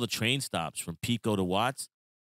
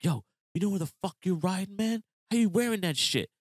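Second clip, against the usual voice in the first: higher mock tough challenge; lightly voiced hostile young kid; menacing gang kid; 11 semitones higher than usual. Quoting gang kid challenging his hat, higher mock menace.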